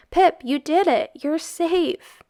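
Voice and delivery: high, loud triumphant cheer. Acted. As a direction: celebrate the finish. Boys cheering Pipkin's win.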